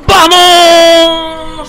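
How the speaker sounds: very loud, high, held cheer; triumphant scream. Real, not acted. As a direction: scream it and stretch the vowel. Cheering the hero on. (alarido largo de victoria)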